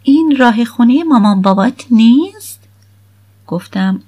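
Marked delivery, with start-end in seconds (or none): 0.0-2.6 put-on frowning teenage girl, a bit higher and louder; a suspicious daughter questioning the route; lightly voiced frowning girl. A narrator's reading. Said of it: "اخم کرد".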